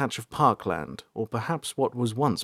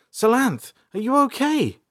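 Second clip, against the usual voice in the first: put-on higher, louder girl's voice; April worried; 13 semitones higher, 4 dB louder than usual. A narrator's reading. April asking shaken Salanth what happened, worried and high. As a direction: ask anxiously. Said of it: "April asked".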